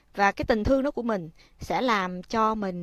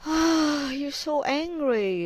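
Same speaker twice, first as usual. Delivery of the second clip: soothing mother; 5 semitones higher, much slower than usual. Shown as a demonstration. Mother calmly soothing her angry child. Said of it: "Mình nói".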